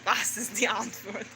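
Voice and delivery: low, hushed, odd-voiced question; imitating manic muttering. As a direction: mutter it like someone cracking up in a corner. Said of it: "bouncing in the corner".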